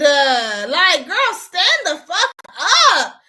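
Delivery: loud, drawn-out indignant yell; real exasperated shouting, slow and emphatic; exasperated outburst. Real, not acted. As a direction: stretch the key words out loud in exasperation. Exasperated outburst over the aunt.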